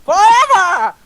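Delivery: high, slow wailing shout; witchy worm. Acted. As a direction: shriek a dramatic exit like a witch. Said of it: "Sounds like a witch".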